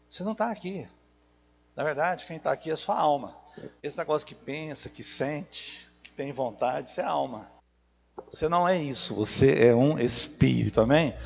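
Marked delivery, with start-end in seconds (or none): 0.1-7.5 soft preaching. Acted.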